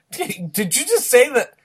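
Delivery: indignant surprise. Real, not acted. Reacting to being compared.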